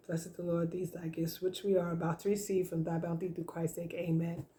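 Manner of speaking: quiet, quick grace before meal; prayer. Acted. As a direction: murmur the blessing softly. Says grace over food.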